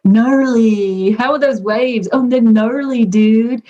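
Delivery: drawn-out American surfer dude impression; American surfer talk. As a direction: put on a laid-back surfer accent. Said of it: "That's American surfer talk".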